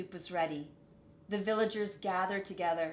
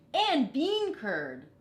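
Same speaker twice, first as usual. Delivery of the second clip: higher, louder, slow eager villager; eager suggestion; 6 semitones higher, 7 dB louder, much slower than usual. A narrator's reading. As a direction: call out a suggestion slowly and keenly. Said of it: "said another".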